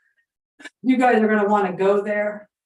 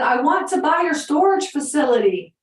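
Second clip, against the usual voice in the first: high, loud call of his name; shouting into the phone; 7 semitones higher, 6 dB louder than usual. Retold or imitated. Caller shouting to a deaf old owner on the phone. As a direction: yell so a deaf man can hear you. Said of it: "And so I started screaming".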